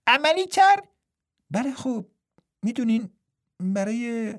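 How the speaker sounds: high, light twin voice finishing his brother's line; a playful twin monk pitched well up. A narrator's reading. Twin asks, then hesitant reply. (صدای زیر و سبک راهب دوقلو)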